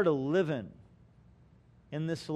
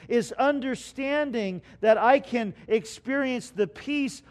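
loud, raised preaching cadence; preaching at full force; 5 semitones higher, 6 dB louder than usual. Acted. Pastor preaching to his church with rising force. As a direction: build it up and preach.

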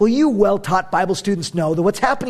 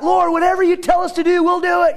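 crying out; 9 semitones higher than usual. Retold or imitated. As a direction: shout it like a people swearing loyalty. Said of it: "they're crying out".

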